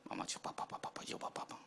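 very soft, voiceless grumbling under the breath; imitated muttering. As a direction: mimic someone mumbling to themselves. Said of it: "kayak ngedumel".

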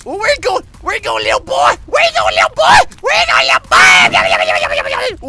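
high taunting sing-song call; giggly sinister sing-song. Acted. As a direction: tease the little boy like a playful villain. Taunting prey as monster.

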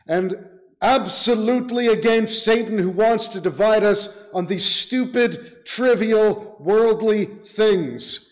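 forceful, deliberate sermon delivery; emphatic preaching. Real, not acted. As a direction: drive each phrase home to the congregation. Preacher exhorts congregation with force.

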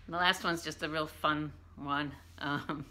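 ordinary talk

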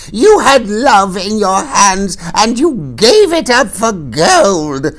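high, loud, slow crone's yell. A narrator's reading. Old woman yelling at the Queen, high slow crone shout. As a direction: shriek the charge at her. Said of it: "suddenly she was yelling".

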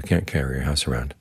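usual speaking voice

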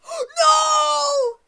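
high, drawn-out "no" at full volume; gasp, then wail it in despair; anguished scream. Real, not acted. Gamer just beaten mid-sentence, anguished scream. Loses suddenly in game.

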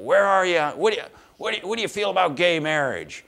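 loud, high call across the room; yelled question. Retold or imitated. Heckler at an ice cream social yelling out, loud and high. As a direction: shout it from a table. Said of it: "the guy yells out".

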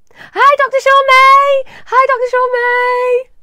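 very high, loud drawn-out holler; calling out. Real, not acted. Greeting the doctor from the stage, calling out loudly. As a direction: sing out a huge greeting at the top of your voice.

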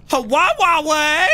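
high, stretched-out exclamation; excited whoop. Real, not acted. Reading out the tournament's prize tickets, excited.

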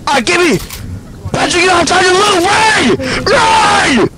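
high, urgent shouts; panicked shouting. Real, not acted. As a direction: yell at him to run. Downed player yelling at teammate.

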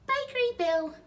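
put-on very high, loud child's voice; the baby copies Bill, squeaky and high. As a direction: chirp it like a toddler. The baby speaks.

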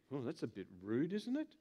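low, soft sniffy complaint rising at the end; huffy guest muttering at the rudeness; offended guest. Acted. Offended guest, acted quote.

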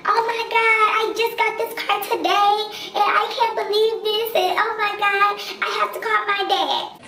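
put-on high, squeaky panicked girl voice; mock the frantic young driver, way up high; high hysterical girl who just got the car today; whiny upset girl. Imitating the upset other driver.

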